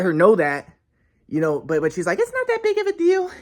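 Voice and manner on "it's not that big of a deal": much higher, airy dismissive parody; mocking Sylvie. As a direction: make her sound careless and dismissive.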